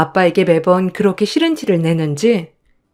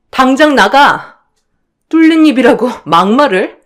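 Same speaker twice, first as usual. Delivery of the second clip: shouting; 6 semitones higher, 6 dB louder than usual. Acted. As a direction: throw her out at full voice. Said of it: "제가 이렇게 빽하니 소리를 지르자".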